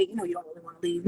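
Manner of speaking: low, soft, quick mimicry of him; imitating his pleading. Imitating him while she packs to leave, low mock pleading.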